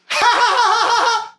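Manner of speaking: very high, loud shriek of laughter. Real, not acted. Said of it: "Yeah, like that".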